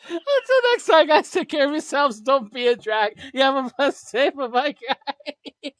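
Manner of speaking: high, loud, laughing through words; laughing sign-off. Real, not acted. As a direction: push the goodbye out while cracking up.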